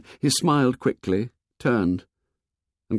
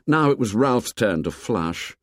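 ordinary delivery, nothing marked

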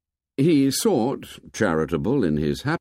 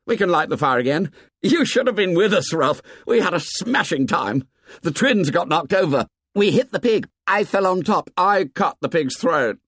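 proud and excited; 7 semitones higher than usual. A narrator's reading. Jack boasting proudly to Ralph about the kill. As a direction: rattle it off bursting with pride.

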